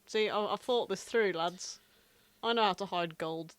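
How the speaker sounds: ordinary talk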